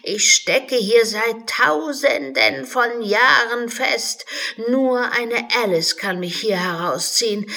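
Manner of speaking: put-on a stuck old unicorn grumbling. The unicorn tells Alice how long it waited, high and complaining.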